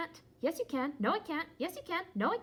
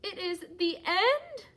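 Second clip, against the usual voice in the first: alarmed question; 4 semitones higher, 5 dB louder than usual. Acted. Gerald mishears, alarmed.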